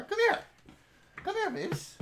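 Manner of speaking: sing-song high call to the dog; high-pitched doggy call; high pet-calling voice. Real, not acted. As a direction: coax the puppy over, high and bright.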